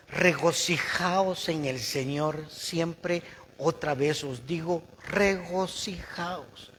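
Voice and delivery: slow, solemn reading of the verse; slow scripture reading. Acted. Preacher reading the verse to the congregation, slow and solemn. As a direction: read it out slowly, savouring each word.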